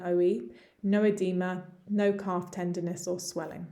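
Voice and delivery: ordinary talk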